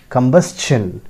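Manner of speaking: combustion as kum-BUS-chun; S then CH in combustion; correct pronunciation. Shown as a demonstration. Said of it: "remember that". (combustion का सही उच्चारण)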